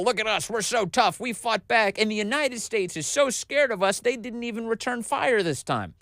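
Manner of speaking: high-pitched imitation of showing off; mock boasting. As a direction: crow to the home crowd, mockingly. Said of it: "wanted to put on a show for their own people".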